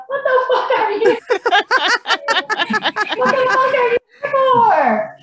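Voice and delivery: high, loud, laughing what the fuck; shocked laughing shout. Retold or imitated. Mother shocked by dog sitters at gate, laughing shout. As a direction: blurt it out mid-laugh, shocked. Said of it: "I'm like".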